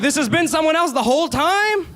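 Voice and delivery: mock shock, voice shooting way up; stunned. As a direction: hit the twist like it just landed. Plot twist realization.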